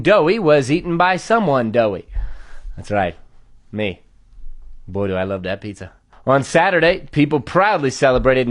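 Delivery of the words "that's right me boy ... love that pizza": deadpan aside. Acted. Comic admits he is the doughy one, deadpan aside. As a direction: drop right down into a low, soft aside.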